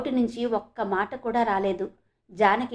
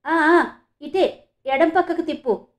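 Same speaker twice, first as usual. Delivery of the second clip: higher-pitched, urgent calling out; excited shout; 5 semitones higher, 5 dB louder, slower than usual. A narrator's reading. Janaki directs the driver to turn, excited shout. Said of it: "అని అరిచింది".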